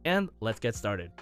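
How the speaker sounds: normal talking voice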